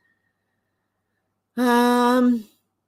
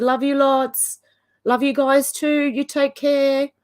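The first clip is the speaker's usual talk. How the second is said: tender goodbye; 6 semitones higher, 4 dB louder, slower than usual. Real, not acted.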